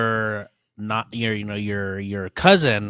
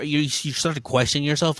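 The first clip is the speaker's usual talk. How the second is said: ordinary delivery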